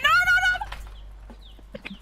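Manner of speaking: sudden high-pitched no's; panicked scream. Real, not acted. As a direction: scream it as the run fails. Game failure, panicked scream.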